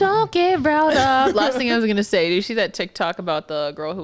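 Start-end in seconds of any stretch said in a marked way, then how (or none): 0.0-1.3 slow, higher teasing repeat; mock her, drawn out; teasing echo. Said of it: "I'm not even about to get riled up".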